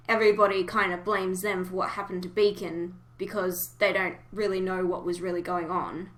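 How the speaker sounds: ordinary talk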